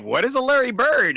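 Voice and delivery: high, loud puzzled question; asking her friend, baffled and loud; confused surprise. Retold or imitated. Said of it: "I was like".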